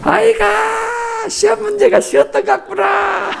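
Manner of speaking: very high, drawn-out sneering dad; mocking father. Father dismisses his child's perfect score with a high shouting sneer. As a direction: stretch the sigh, then sneer it out.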